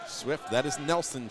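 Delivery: ordinary delivery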